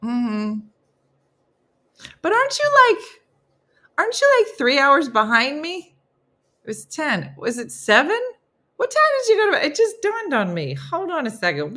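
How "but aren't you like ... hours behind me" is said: startled question. Real, not acted. Suddenly confused about time zones.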